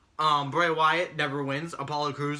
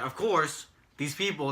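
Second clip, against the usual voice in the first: usual speaking voice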